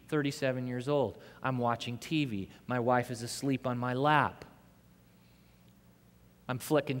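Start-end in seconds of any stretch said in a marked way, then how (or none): none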